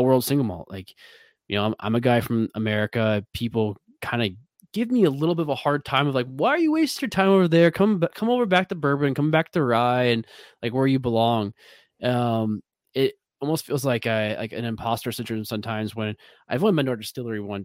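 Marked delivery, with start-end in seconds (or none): 6.3-11.5 higher, louder mock scolding; playful nagging friend; teasing friends. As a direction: rib him to come home to bourbon. Friends teasing him to return.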